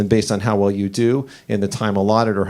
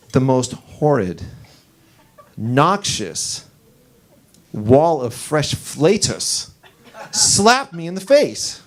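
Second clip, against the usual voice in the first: dramatic slow-down; much slower than usual. Acted. Dramatic build to the punchline.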